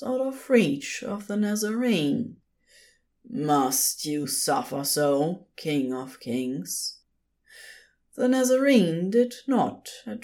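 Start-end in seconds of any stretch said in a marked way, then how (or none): 3.3-7.0 coil the words slowly, tempting; slow silky serpent. A narrator's reading.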